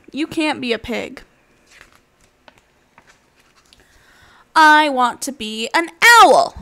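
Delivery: lively storybook voice with animal voices; reading to children. Acted. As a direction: read it like a bedtime picture book. Reads picture book aloud.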